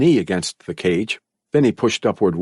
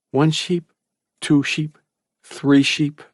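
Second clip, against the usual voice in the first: higher, sleepy sheep count; a boy counting sheep to fall asleep, higher; 7 semitones higher, slower than usual. A narrator's reading. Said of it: "tried to sleep by counting sheep".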